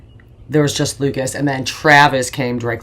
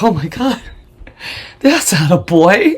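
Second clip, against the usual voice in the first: loud, raised mock amazement; astonished doctor; 5 semitones higher, 7 dB louder than usual. Doctor sees the huge newborn, mock astonishment. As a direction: exclaim in exaggerated shock.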